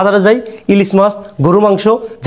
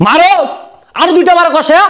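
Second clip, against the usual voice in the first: urging shout; 9 semitones higher than usual. Acted. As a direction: yell at him to hit harder. Father urging son to hit.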